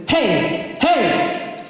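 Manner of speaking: called hey from the diaphragm; rising called hey; gut-driven hey. Shown as a demonstration. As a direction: bark hey from the belly. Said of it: "You never get your voice loud by doing this".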